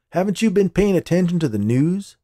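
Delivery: high, loud incredulous question. Acted. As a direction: ask as if everyone already knows.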